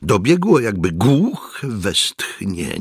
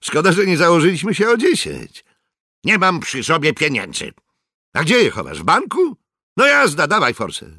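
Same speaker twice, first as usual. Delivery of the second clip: taunting soldier voice pitched higher; cocky bettor collecting winnings, higher voice; mocking soldier; 13 semitones higher, 4 dB louder, faster than usual. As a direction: needle him about the money. Steinbrenner taunting over bet.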